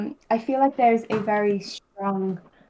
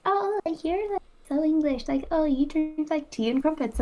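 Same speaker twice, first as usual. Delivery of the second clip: higher-pitched mock friend voice; teasing friends; 6 semitones higher, 4 dB quieter than usual. Friends teasing the new English arrival, in a high mock voice. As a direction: imitate friends ribbing you, pitched up.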